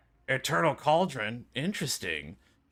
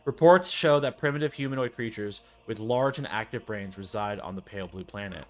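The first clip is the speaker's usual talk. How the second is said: usual speaking voice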